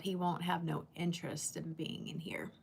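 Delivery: whispered aside; a real whisper to herself. Real, not acted. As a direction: trail off into a whisper. Muttered aside about the gnat.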